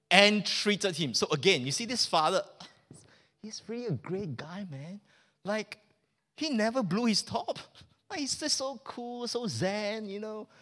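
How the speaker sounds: normal talking voice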